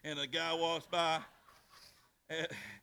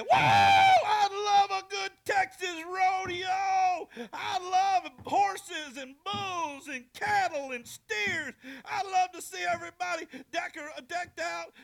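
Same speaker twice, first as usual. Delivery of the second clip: much higher, shouted rodeo joy; excited blind preacher; 11 dB louder, slower than usual. Retold or imitated. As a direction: whoop it up like a fired-up preacher at a rodeo. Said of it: "he said".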